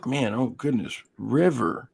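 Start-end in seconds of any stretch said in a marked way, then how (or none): none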